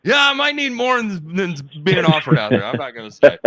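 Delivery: laughing loud. Real, not acted. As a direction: laugh it out, embarrassed. Laughing about needing therapy.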